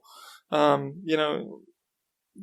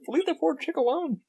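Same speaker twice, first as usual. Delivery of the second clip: retold rebuke; 12 semitones higher than usual. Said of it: "I was like".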